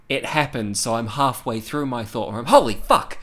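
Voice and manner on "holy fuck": loud, high burst of shock; shocked outburst. Retold or imitated. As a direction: blurt it out, re-enacting sudden shock. Acting out his shocked reaction.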